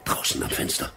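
gehauchtes, ängstliches Flüstern. Acted. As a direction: leise flüstern, damit keiner draußen es hört. Nächtliches Geräusch, Angst vor Entdeckung. (ängstlich geflüstert)

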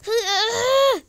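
loud high cry of pain; pain shout. Pretend. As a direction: shout out as if hurt. Hurt character crying out.

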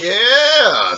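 high, loud drawn-out whoop; triumphant cheer. Real, not acted. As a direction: shout it like you just won. Cheering a correct guess.

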